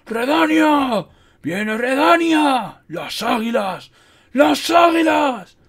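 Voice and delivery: high, drawn-out battle cry; loud, stretched rallying shouts; bellowing. A narrator's reading. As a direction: bellow each cry long and high across the field. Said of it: "bramó, agitando su bastón".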